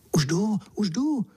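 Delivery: high, eager calling out; high-pitched, keen reply. A narrator's reading. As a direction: call back keenly that you're coming. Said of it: "zvolal jsem horlivě".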